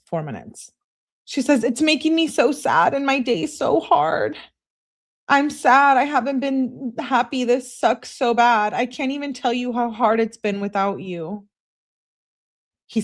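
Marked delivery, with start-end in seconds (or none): 1.6-11.5 high, acted lament; hammed-up lovesick misery; distraught pleading. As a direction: pour out mock heartbreak. Acts out sad, desperate texts.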